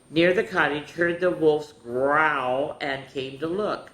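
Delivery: emphatic read-aloud, key words drawn out; children's storytime reading. A narrator's reading. Telling children how the woodcutter came to look, storytelling voice. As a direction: tell the tale to little ones, leaning on the big words.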